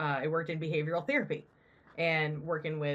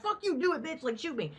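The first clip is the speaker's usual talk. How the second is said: defiant dare; 8 semitones higher than usual. Retold or imitated. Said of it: "some people who be like".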